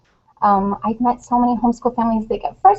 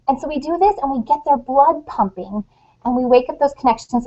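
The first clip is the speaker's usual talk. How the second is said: ordinary talk, nothing marked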